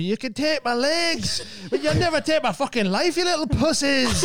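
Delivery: high, slow mock-defiant shout of a man with no legs; defiant injured man. Mocking the hospital video man, high and defiant. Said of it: "There's a video of him going".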